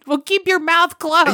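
impatient retort. Retold or imitated. Wife scolding while pouring alcohol.

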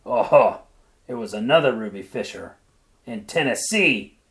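husband crying out to dismiss his wife; loud mocking cry. A narrator's reading. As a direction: shout her down, sure he's right. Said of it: "cried her husband".

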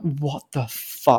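low, slow stunned what the fuck; mild mock shock at the film; slack-jawed disbelief. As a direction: drop the jaw and let it fall out slowly. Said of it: "slack-jawed".

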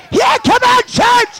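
very high, loud preacher's cry; rousing shout. Real, not acted. Preacher rallies his church, rousing shout. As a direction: shout to stir up the congregation.

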